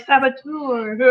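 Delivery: playful catchphrase accusation in a put-on voice; mock accusation. Showing how she teases friends who do something wrong, mock accusation. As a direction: call out the culprit in jest.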